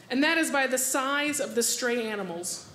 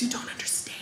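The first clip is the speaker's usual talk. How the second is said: whispered, soft and earnest; hushed insistence; 2 semitones lower, 8 dB quieter than usual. Retold or imitated. Horse trainer telling the comic, whispered. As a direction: let her in on a secret. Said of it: "She goes".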